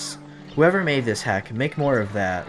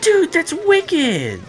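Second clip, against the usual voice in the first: excited squeal; 5 dB louder, slower than usual. Real, not acted. Thrilled by spicy spray.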